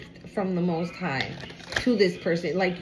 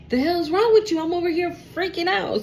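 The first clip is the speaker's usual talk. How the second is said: freaked-out outburst; 9 semitones higher than usual. Retold or imitated. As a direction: snap at them in a panic. Anxious partner snaps at calm one.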